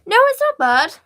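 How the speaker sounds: high, loud eager "not bad"; excited reassurance. Real, not acted. Reassuring excitedly about drawing.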